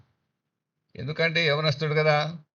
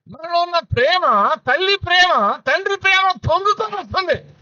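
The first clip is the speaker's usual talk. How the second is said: higher, rising swell of feeling; swelling preaching; 12 semitones higher than usual. Acted. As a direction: let the love well up in the voice. (పొంగే భావంతో ఎత్తైన స్వరం)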